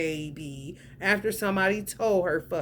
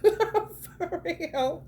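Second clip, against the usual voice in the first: laughing; 10 semitones higher than usual. Real, not acted. Laughing while insisting on truth.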